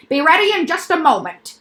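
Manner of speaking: high, loud showman doctor; a bouncy, sly quack doctor; eccentric doctor voice. Acted. Doctor character voice.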